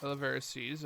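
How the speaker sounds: usual speaking voice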